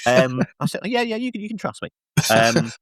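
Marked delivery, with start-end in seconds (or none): 0.9-1.9 high, meek mock promise; a squeaky, sheepish husband's promise; meek reply. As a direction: promise sheepishly, pitched up and soft. Own meek reply, mocked.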